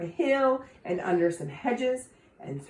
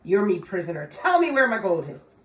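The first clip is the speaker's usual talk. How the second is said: triumphant cry; 4 semitones higher, 8 dB louder than usual. Acted. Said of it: "he grabbed ... and cried".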